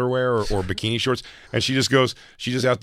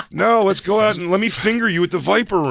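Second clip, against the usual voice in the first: higher, louder pushy voice; mock horny husband; 11 semitones higher, 6 dB louder than usual. Husband pushing his wife to party, loud mock voice. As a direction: push her to go out, hammed up.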